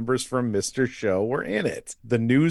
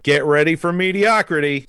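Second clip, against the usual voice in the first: higher, louder mock hype; mock announcer; 6 semitones higher, 7 dB louder than usual. Movie's opening song warning the audience, mock hype. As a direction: announce it big, like a sarcastic hype man.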